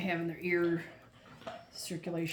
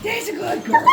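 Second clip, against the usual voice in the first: high excited praise; 12 dB louder than usual. Real, not acted. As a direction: gush over the dog. Praising dog after shake.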